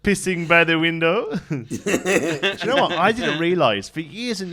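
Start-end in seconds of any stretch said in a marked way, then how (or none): 0.0-1.3 Officer Crabtree's mangled French-English; over-the-top fake French, laughing through it; silly Allo Allo policeman impression; mock French accent. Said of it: "like that fella off of Hello".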